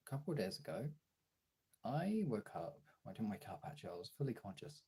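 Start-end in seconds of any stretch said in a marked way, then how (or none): none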